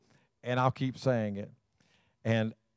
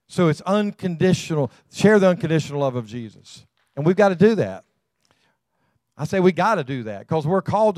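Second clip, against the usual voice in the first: raised, ringing preacher's call to the congregation; preaching at full force; 5 semitones higher, 6 dB louder than usual. Acted. Preacher urging the congregation, loud and fervent. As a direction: lift the church up with a loud fervent cry.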